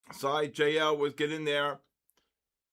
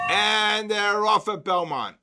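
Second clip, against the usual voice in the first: slow, louder, higher race-call voice; race caller; 6 semitones higher, 7 dB louder than usual. Pretend. Trader on stream kicks off the rally in a horse race announcer voice. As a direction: call it like the horses just left the gate.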